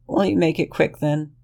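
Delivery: high, small frightened plea; cowering Lexington. Acted. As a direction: shrink into a high whimpering excuse.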